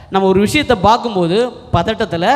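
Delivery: ordinary talk, nothing marked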